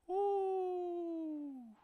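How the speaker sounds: ordinary talk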